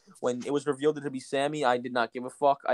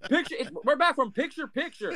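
higher announcer call, we're back from picture, picture; JR announcer impression; 10 semitones higher than usual. Mocking the broadcast's return from commercial. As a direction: hype the return like a play-by-play man. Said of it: "say it like J R".

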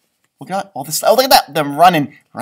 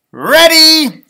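much higher and louder, triumphant; excited cheer; 11 semitones higher, 13 dB louder than usual. Acted. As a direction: shout it like a team ready to go. Characters announce they are ready.

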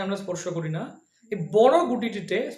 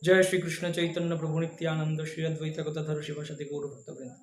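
murmured mantra; 4 semitones lower, 5 dB quieter than usual. Shown as a demonstration. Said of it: "আবার বলবো".

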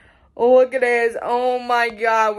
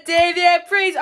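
ordinary talk

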